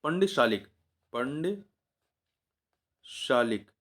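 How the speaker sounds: teacher stretching a hard word; slow dictation. Shown as a demonstration. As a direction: spell out पंडशालिक syllable by syllable. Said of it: "इसका तत्सम क्या होगा".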